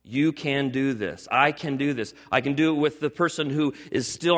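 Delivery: ordinary delivery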